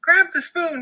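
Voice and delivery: high loud yell. Acted. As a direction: shout the instruction. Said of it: "yelled Dakota".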